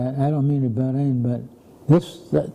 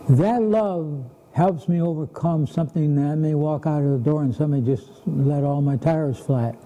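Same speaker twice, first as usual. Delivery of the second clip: normal talking voice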